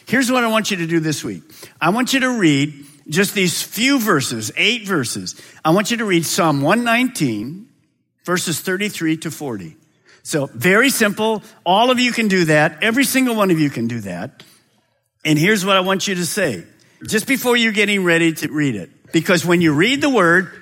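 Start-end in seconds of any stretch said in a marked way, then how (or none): none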